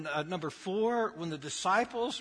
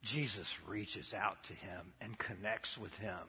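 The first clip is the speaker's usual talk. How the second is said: ordinary delivery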